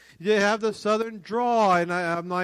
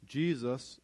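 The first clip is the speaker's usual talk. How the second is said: ordinary talk, nothing marked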